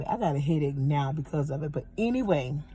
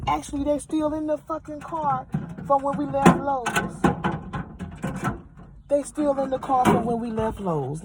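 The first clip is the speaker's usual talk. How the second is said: much higher-pitched exasperated complaint; aggrieved insistence; 10 semitones higher than usual. Real, not acted. Showing viewers the cracked drawers as proof, aggrieved. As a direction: insist on it in a high, put-out voice.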